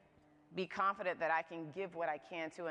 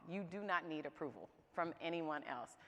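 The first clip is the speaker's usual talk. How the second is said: ordinary talk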